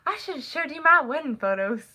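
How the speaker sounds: high-pitched imitated Southern twang; relative's Southern accent. Relative imitated talking about wedding pictures, high Southern accent. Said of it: "a southern accent".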